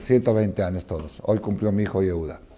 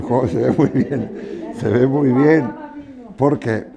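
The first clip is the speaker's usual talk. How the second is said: laughing through se ve muy bien; amused at his own joke, laughing; 4 semitones higher, slower than usual. Real, not acted. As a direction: chuckle out the words.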